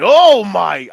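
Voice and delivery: loud, high "oh my" of amazement; excited outburst. Retold or imitated. Fan reliving Herbert's touchdown, excited shout. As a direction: burst out loud like a fan leaping up. Reenacts his couch reaction to touchdown.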